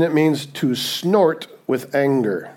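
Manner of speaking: slow, landing on "snort"; slow reveal. Shown as a demonstration. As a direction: drop the payoff slowly, hitting "snort". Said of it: "are you ready for this one?".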